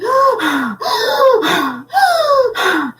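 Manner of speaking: high, sudden gasp for air; startled inhale; sharp gasp. Retold or imitated. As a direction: gasp as if jolted back. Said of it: "had to come back and be like".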